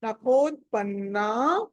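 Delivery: నఖోత్పన్నా slowly, last vowel held long; long final "naa". Shown as a demonstration. Teacher asking for a dirgham, నఖోత్పన్నా with long "naa". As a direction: lengthen the ending of నఖోత్పన్నా. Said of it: "నాకి దీర్ఘం పెట్టుకోవాలి".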